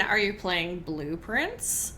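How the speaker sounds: ordinary talk, nothing marked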